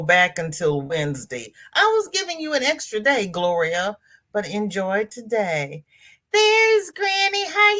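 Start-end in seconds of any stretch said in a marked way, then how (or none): 6.3-7.7 delighted greeting. Real, not acted. Spots a viewer joining.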